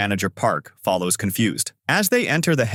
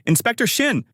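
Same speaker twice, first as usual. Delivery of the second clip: high-pitched call to a colleague; 12 semitones higher than usual. A narrator's reading. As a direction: call after someone, pitch raised. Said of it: "The female coworker called out".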